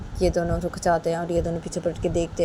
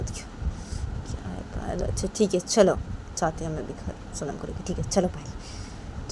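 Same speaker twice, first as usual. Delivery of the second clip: giving in softly; 5 dB quieter than usual. Acted. Poonam gives in to Payal, soft and fading. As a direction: concede quietly, almost whispering. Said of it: "तो पूनम पायल को".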